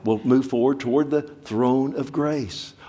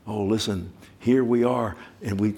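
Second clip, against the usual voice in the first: ordinary delivery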